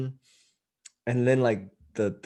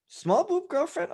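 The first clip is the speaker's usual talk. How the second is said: mock innocent squeak; slower than usual. Quoting the guy's innocent question.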